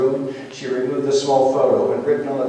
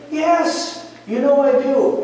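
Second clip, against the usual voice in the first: high-pitched pleading assurance; earnest protest; 11 semitones higher, slower than usual. Retold or imitated. Peter assuring Jesus of love, high and earnest. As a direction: insist on it with voice lifted high. Peter answering under pressing questions.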